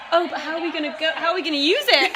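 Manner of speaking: high, laughing retold worry; amused mock concern. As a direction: quote a worried question, cracking up by the end. Retelling worried question while laughing.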